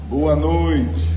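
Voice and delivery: booming, projected boa noite; loud greeting. Shown as a demonstration. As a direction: say Boa noite loud and full, for the back row. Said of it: "A voz de cada pessoa". (cumprimento em voz bem alta e projetada)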